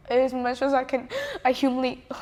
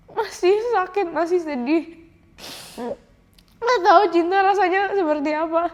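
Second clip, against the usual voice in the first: tearful; 6 semitones higher, 4 dB louder than usual. Real, not acted. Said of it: "mohon maaf aku nangis".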